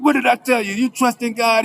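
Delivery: high-pitched preacher's cry; jubilant preaching. Retold or imitated. Officer reminding the acquitted man to trust God, jubilant preaching. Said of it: "he started preaching".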